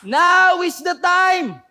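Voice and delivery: high, loud, drawn-out rallying cry; shouted battle command. Acted. Joshua commands the Israelites to shout, full-voiced shouting. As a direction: belt out each order, pausing between. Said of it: "sinabi ni Joshua sa mga Israelites".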